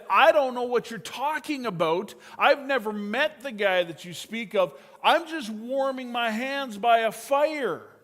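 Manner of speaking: loud, high, heated denial; angry denial. Retold or imitated. Peter angrily denying he knows Jesus, a loud heated retort. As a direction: snap it out like a cornered man. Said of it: "he got mad and he said".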